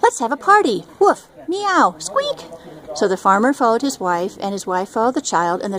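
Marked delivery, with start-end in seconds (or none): 0.0-0.8 high, excited party call; excited suggestion. Acted. Farmer's family proposing a party over the cheese, excited. 1.0-2.5 high voiced dog, cat and rat noises; animal cries. Dog, cat and rat answering the party idea in animal cries.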